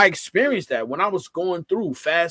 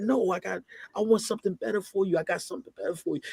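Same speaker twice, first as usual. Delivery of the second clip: softer, fast, a hint of a gentle God; voicing God gently; 2 semitones higher, 7 dB quieter, faster than usual. God answering the worshipper, lightly voiced, softer and fast. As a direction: let it fall away soft and quick like a reassurance. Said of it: "But he said".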